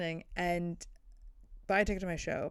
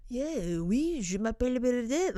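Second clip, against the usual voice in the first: a light put-on French accent, higher; mock French accent; 6 semitones higher than usual. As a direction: play a breezy Frenchman saying his name. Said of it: "his French accent".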